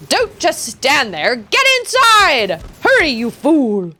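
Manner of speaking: drawn-out, rising shout to hurry; urgent shouted order. Acted. Character orders a fool to get inside, urgent shout. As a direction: shout them inside in alarm.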